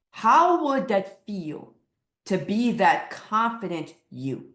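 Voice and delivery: usual speaking voice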